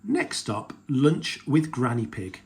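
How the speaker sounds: plain reading voice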